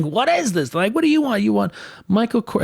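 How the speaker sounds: high mock disbelief; incredulous mockery. At handbag sellers outside meetings, high mock disbelief. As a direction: act baffled and amused by the sellers.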